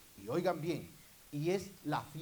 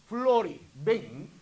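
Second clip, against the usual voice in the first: loud, high call of a name, like God summoning her; 6 semitones higher, 15 dB louder, much slower than usual. Acted. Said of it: "Cuando Dios dice". (Dios llamando fuerte: "¡Flori, ven!")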